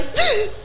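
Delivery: high startled gasp; sharp gasp. Retold or imitated. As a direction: suck in air in fright. Sees hooded man, thinks it's him.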